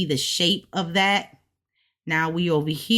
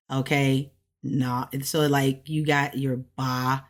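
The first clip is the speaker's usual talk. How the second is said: ordinary talk, nothing marked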